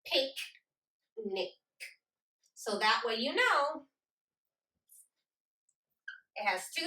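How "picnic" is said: "Picnic" in two separated beats, pic and nic; split into syllables. Shown as a demonstration. Teacher clapping them out, "Picnic" broken into pic and nic. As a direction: say "Picnic" with a long pause between its syllables. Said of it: "So let's clap them out".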